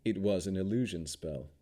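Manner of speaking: usual speaking voice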